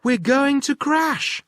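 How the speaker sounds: high, loud alarm shout; panicked shout. Acted. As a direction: shout the danger out. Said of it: "yelled Chip".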